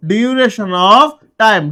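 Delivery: duration of time, stretched very slowly; slow drilled phrase. Shown as a demonstration. Teacher drilling students on for, very slow. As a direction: lay each word down slowly. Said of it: "for ব্যবহার করব".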